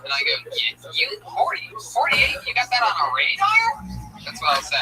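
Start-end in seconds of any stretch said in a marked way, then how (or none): 0.5-4.0 incredulous high voice. Retold or imitated. Driver questioning a cop's radar, voice shooting up high. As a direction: voice jumps way up in mock disbelief. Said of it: "I'll go up with my voice like that".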